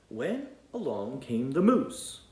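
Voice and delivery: ordinary delivery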